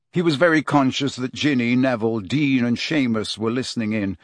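plain reading voice

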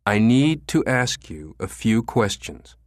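slow, overpronounced French-Canadian-aware English, every word spelled out; overpronouncing. Acted. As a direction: speak as if to a deaf person. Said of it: "overpronouncing his words as if speaking to a deaf person".

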